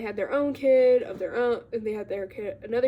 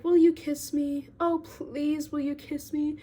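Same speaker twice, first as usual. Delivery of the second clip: pitched-up begging, a touch whiny; mock whining plea for a kiss; whiny pleading; 4 semitones higher than usual. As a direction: beg her like a lovesick boy. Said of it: "really whining about it".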